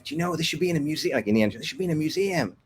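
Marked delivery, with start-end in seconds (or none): 0.0-1.1 pitched-up retold outburst, kept at talking level; mock yelling. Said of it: "they start yelling at my wife". 1.5-2.6 half-hearted retold yell, voice lifted high; mock yelling. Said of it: "they start yelling at my wife".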